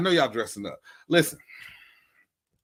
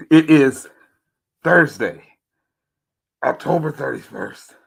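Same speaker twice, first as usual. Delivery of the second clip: demon voice; 8 dB louder than usual. Acted. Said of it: "Try to sound like a demon, mess your throat up".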